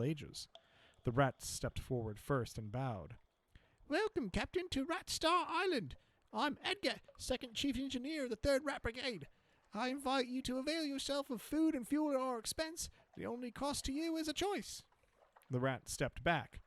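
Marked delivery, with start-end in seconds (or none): 3.9-14.8 high squeaky rodent voice; rat engineer voice. Acted. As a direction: pitch way up, eager little rat. Rat engineer greets the captain.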